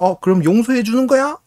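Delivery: 높게 띄운 아이 질문; 용서를 바라며 목소리를 올려 묻기; 기대하는 아이 흉내. Retold or imitated. 아이가 용서를 묻는 장면. (들뜬 꼬마 말투)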